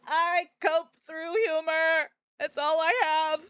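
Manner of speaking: drawn-out half-yell; slow loud declaration. Real, not acted. Streamer to chat, slowly half-shouting a mock confession. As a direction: proclaim it slowly and loudly.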